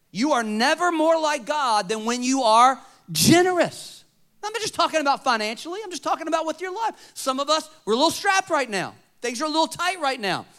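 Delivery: high, loud pulpit preaching; preaching at full force. Acted. Pastor urges the church to give, preaching hard. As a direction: drive it home to the crowd.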